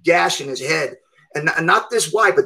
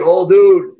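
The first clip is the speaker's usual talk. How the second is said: alarmed call; 8 dB louder than usual. Retold or imitated. Bystanders warn him, alarmed.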